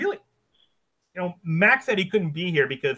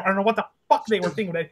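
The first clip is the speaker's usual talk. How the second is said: normal talking voice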